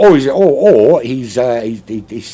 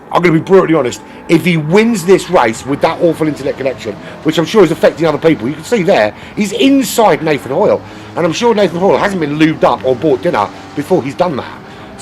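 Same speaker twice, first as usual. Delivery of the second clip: excited, higher-pitched sim racing commentary; live race play-by-play; 8 semitones higher, 6 dB louder than usual. Real, not acted. Streamer calling the lagging racer off the line, excited race commentary. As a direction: call the race start with rising urgency.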